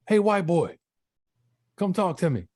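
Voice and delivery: high, loud holler across the hall; calling out. Retold or imitated. Older inmate pestering the man across the hall, calling out. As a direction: imitate a neighbor hollering, pitched well up. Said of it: "don't yell over here".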